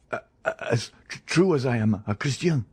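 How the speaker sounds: stammering and stumbling over the first sounds in fear; terrified stammer. Acted. Caderousse to the Count, stammering in terror. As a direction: choke out the words, broken with fright. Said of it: "stammered Caderousse".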